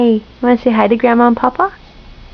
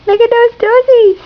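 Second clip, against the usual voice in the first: squeaky high coo over the toesies; 9 semitones higher than usual. Real, not acted. Mother cooing over her baby's toes, very high. Repeat, much higher to baby.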